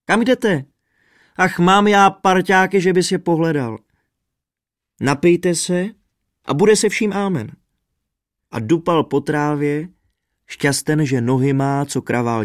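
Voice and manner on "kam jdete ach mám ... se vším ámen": high, loud teasing call; raised voice, calling them back; calling after friends. A narrator's reading. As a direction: hail the runaways heartily. Laughing trumpeter calls fleeing friends.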